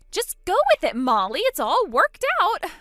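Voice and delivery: a high, loud mock of his calm coaxing; imitating Keith. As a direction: mimic his smug coaxing through the door. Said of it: "He's outside the door right now saying".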